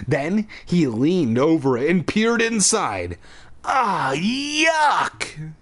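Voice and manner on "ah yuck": much higher, drawn-out yuck; disgusted cry. A narrator's reading. Chuck finds a gross mask in the carton, disgusted cry. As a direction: recoil from something gross. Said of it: "he cried".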